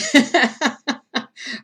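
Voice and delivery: high burst of laughter; loud laugh. Real, not acted. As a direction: laugh at her own yelling. Laugh after yelling.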